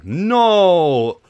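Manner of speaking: loud, high, drawn-out cry; dismayed shout. Real, not acted. Player loses his target mid-fight, dismayed shout.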